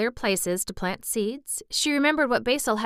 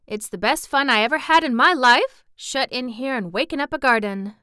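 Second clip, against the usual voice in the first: put-on louder, higher Yorkshire boy; country lad, delighted; 5 semitones higher, 6 dB louder than usual. Boy enjoying waking up a garden, delighted. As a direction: bubble with enthusiasm in a country dialect. Voices Dickon in story.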